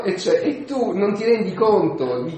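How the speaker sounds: high, loud mock-indignant rant; aggrieved Peter. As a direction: complain indignantly, piling up the grievances. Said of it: "Pietro poi si incazza un po'". (sfogo risentito e teatrale)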